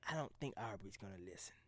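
whispered inner voice agreeing. Shown as a demonstration. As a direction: let the other part of you whisper it. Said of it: "a piece of me is just like".

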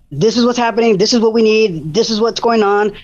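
usual speaking voice

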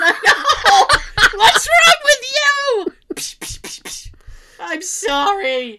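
high shrieking, slow hysterics; hysterical parody. Pretend. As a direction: play the hysterical passenger from Airplane. Airplane movie parody scene.

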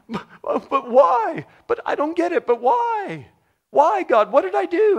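very high, loud whiny why; whining protest. Retold or imitated. Punished person whining to God, very high and loud. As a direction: bleat like a punished child who claims innocence. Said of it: "they're standing there going".

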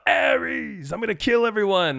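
high, loud god's rage; mock rage. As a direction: ham up a furious god. Imitating Ares' rage.

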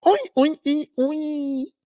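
very high, repeated mock squeals; hammed-up high-pitched cries.

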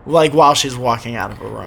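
ordinary talk, nothing marked

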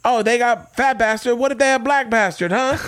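high-pitched comic voice doing a joke; squeaky, hammed-up standup voice; Ralphie May bit. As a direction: rattle off the jokes in a high comic voice. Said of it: "he would just hammer you".

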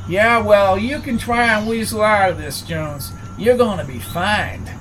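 a hint of a smug work boss threatening a fine; Tex the crew boss. A narrator's reading. Gruff gas crew boss Tex.

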